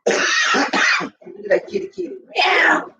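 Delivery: cooing at cat. Real, not acted. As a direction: coo sweetly to a kitty.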